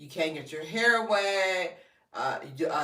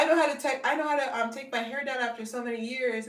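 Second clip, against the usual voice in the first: excited fan; 8 semitones higher, faster than usual. Retold or imitated. Quoting an excited viewer testimonial.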